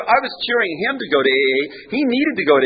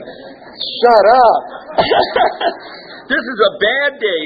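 higher, laughing mock annoyance; mock protest laughing; 6 semitones higher, much slower than usual. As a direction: play at grumbling, laughing.

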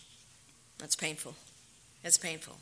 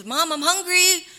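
put-on high, louder plea of a hungry little boy; child begging; 9 semitones higher, 6 dB louder than usual. Son pleading with his mother, high and louder. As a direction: whine it up high like a small child. Son begs again that night.

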